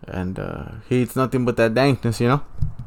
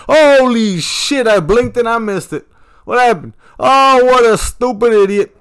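excited; 10 semitones higher, 6 dB louder than usual. Real, not acted. Missed goal, reacting loudly.

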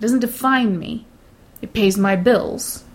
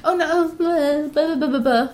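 put-on higher, slow, guilty little-girl voice; mocking two flustered women acting like little girls; little girls in trouble; 7 semitones higher than usual. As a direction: mimic caught schoolgirls brushing it off. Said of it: "like they're two little girls in trouble".

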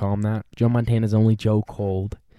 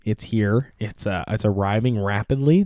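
ordinary delivery

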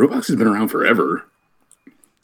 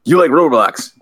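interviewer voice; 7 semitones higher than usual. Pretend. Host starting an interview.

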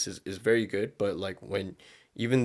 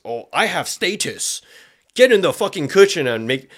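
pompous attitude; 6 semitones higher, 6 dB louder than usual. Retold or imitated. Status-proud man talking down to a woman, pompous. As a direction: look down your nose and command. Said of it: "give a pompous attitude".

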